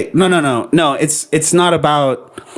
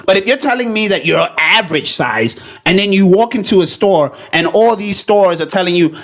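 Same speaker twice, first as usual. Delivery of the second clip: ordinary talk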